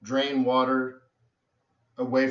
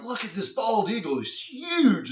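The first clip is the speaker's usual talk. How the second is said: amazed onlooker; 8 semitones higher than usual. Retold or imitated. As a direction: pitch soaring up on "huge". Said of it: "I'm like".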